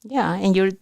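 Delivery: normal talking voice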